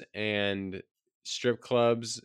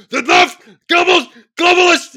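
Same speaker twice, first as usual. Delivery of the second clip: high, loud, slow ranting shout; Alex Jones; 15 dB louder, much slower than usual. Retold or imitated. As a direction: scream it like a conspiracy ranter. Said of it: "Reminds me of Alex Jones".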